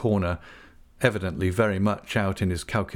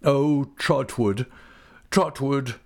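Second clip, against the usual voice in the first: high, despairing cry; wretched man appealing to a friend; anguished exclamation; 6 semitones higher, slower than usual. A narrator's reading. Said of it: "exclaimed Mister Wickfield, wringing his hands".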